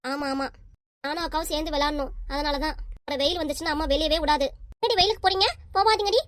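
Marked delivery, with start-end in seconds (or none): 4.8-6.2 put-on child aping her mother's nagging, pitched up; mimicking mom's scolding. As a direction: kid parodies mom forbidding play in the sun. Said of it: "அப்படின்றோம்".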